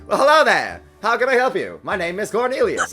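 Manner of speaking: high, loud over-friendly greeting; cheery manager voice. Acted. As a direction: welcome them like a chipper clerk.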